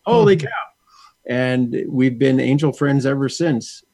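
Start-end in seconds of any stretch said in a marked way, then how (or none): 0.1-0.5 high, punchy mock astonishment; retold amazement. Reacting to a fellow angel talker, retold amazed exclamation. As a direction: re-enact amazement, pitched well up.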